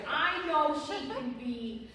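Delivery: ordinary talk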